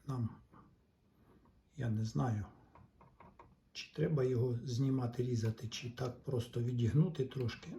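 ordinary talk, nothing marked

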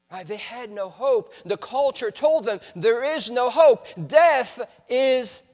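normal talking voice

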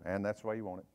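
wife's retort. Retold or imitated. Wife answers her husband's complaint in a low, dry tone. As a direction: say it low and dry, case closed.

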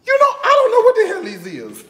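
high-pitched, hurried, amused admission; high amused squeal. Real, not acted. Telling viewers she can't identify the teas, high amused squeal. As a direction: squeal it high and quick, tickled. Admits cluelessness about the teas, cracking up.

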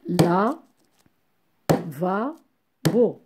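La, va, bo as three separate beats; syllable by syllable. Shown as a demonstration. Teacher counts three syllables for pupils, each said separately. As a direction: leave a clear pause between La, va and bo. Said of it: "Trois syllabes".

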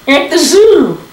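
high, cheeky puppet voice; a wisecracking little dummy; squeaky ventriloquist dummy. Acted. Dummy punchline.

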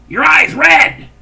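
loud, drawn-out blurt. Retold or imitated. Creepy old man blurting out.

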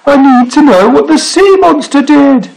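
high, urgent pleading shout; cried out. A narrator's reading. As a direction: demand it over the wind. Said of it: "he cried".

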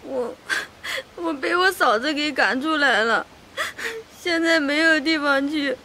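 tearful, voice breaking as she explains; crying. Acted. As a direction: sob out being thrown out by her sister-in-law. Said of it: "你怎么又哭了？".

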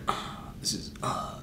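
breathy, hushed mock hesitation; hushed, half-whispered impression of a hesitant drummer; mimicking bandmate. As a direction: imitate him fumbling under your breath. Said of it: "You're like, you're like".

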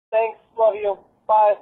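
usual speaking voice

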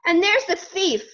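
high, loud accusing shout; storybook shout pointing out a thief. A narrator's reading. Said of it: "he shouted".

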